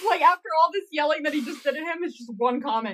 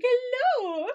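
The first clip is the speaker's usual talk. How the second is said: mock sweet hello; 12 semitones higher than usual. Fans retelling the taunt, high cutesy hello. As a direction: wave it in his face.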